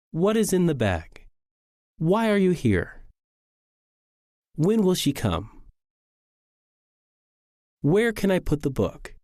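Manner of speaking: wh-questions dropping on "bag", "here", "come", "book"; falling intonation. Shown as a demonstration. Teacher rereading example questions, each with falling intonation. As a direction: let the last word fall, as on "bag" and "book". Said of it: "They all end in falling intonation".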